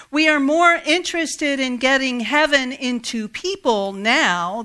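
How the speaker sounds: loud litany, with a slow, swelling Hey, ain't that good news refrain; fervent preaching. Acted. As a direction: lift the congregation with every line. Reads the creed with full force.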